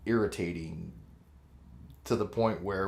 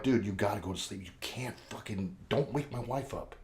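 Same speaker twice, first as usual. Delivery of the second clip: hushed plea; 2 semitones lower, 9 dB quieter than usual. Retold or imitated. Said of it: "I'm like".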